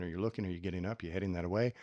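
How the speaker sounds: ordinary talk, nothing marked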